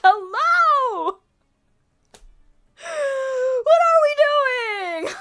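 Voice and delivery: high, loud, drawn-out mock outrage; indignant yelp. Recounting being pulled onto someone's lap, indignant yelp. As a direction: shriek it in exaggerated disbelief. Retelling reaction to being grabbed.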